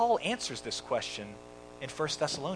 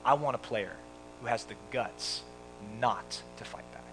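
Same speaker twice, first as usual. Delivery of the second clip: retold measured reply; 4 semitones lower, 6 dB quieter than usual. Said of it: "Branch Rickey's response is great".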